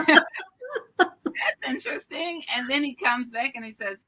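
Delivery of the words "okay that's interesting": amused reply. Retold or imitated. Man's amused reply, laughing.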